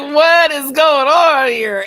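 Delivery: high, loud, slow, shouted alarm. Retold or imitated. Retelling her alarm at the soaked figure, shouting slowly. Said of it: "I was like".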